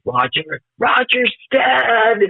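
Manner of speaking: high, drawn-out imitation of screaming; imitating a frantic scream, not full volume; retold scream. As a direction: stretch DEAD in a high mock scream. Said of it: "screaming, like".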